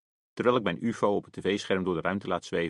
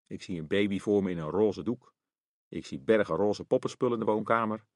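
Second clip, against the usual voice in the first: plain reading voice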